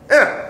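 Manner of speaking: put-on high, whiny toddler grunt of protest; imitating a toddler's high protest grunt. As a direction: grunt like a one-year-old told what to do. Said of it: "he goes".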